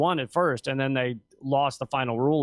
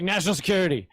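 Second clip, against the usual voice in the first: usual speaking voice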